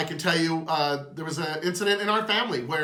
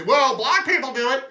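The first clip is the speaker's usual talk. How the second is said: mock whiny excuse; 5 semitones higher, 6 dB louder than usual.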